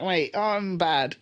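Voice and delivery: ordinary talk, nothing marked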